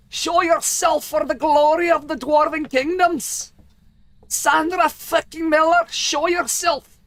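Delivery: loud, high-pitched hoarse dwarf bellow, drawn out; hoarse throaty dwarf. Shown as a demonstration. Dwarf demanding a ghost show itself, hoarse throaty bellow. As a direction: push it from the throat like a roaring dwarf. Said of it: "deeper in my throat and be a bit hoarse".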